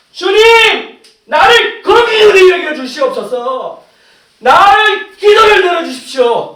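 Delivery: slow, high, shouted prayer; earnest pleading. Acted. Believer begs the Lord to listen, earnest shouted pleading. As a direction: beg at full voice, drawing out each plea. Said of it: "간절히 구해야 된다는 거예요".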